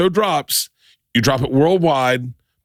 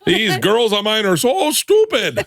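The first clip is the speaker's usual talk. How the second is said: put-on high, slow, folksy Canadian accent; 9 semitones higher, slower than usual. Father complaining about his daughters, in a hammed-up Canadian accent. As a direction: play a dopey Canadian dad, drawn out. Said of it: "with a Canadian accent".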